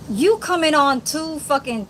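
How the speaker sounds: ordinary talk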